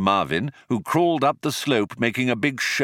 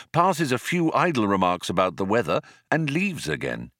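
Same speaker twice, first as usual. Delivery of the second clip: usual speaking voice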